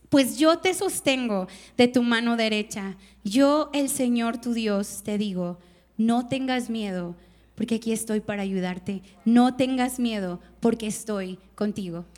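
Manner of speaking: solemn verse reading; scripture reading. Acted. Reads Isaiah 41:13 aloud.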